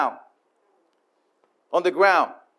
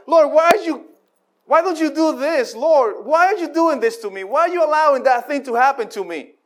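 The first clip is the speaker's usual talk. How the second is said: complaining prayer; 6 semitones higher than usual. Acted. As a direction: cry out at God like a wronged child. Acted complaining prayer to God.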